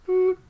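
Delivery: high little boop; imitated bell beep. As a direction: squeak out a tiny beep. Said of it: "just very quietly in the background".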